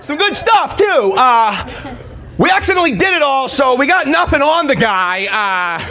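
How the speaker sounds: very high, giddy sheepish report; mock coked-up undercover, high pitched; high stoned undercover. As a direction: play it like a guilty cop caught high. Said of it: "I'm just like".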